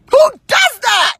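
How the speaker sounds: high-pitched incredulous shout; exasperated, high outburst; real outrage. Real, not acted. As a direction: yell it in disbelief. Venting real outrage at incident.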